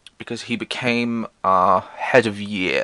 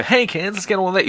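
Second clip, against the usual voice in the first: mock chummy teacher; 9 semitones higher than usual. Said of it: "Not like a".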